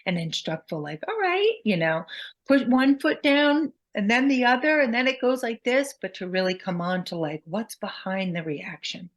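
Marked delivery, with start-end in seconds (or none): 1.1-5.9 higher, encouraging coaching voice; kind instruction. Shown as a demonstration. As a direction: gently guide a toddler on a bike. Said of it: "You have to be kind, helpful".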